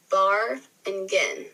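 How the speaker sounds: "bar and gin" slow, each syllable set apart; syllables separated. Shown as a demonstration. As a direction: sound out "bar and gin" piece by piece. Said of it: "break down those syllables".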